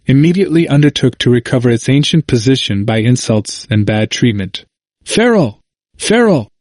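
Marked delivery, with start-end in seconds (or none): 5.0-6.5 high-pitched narrated cry of a crowd; restrained, high exclamation that falls away. A narrator's reading. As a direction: call the name out high, without added volume. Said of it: "cried the Reformed party".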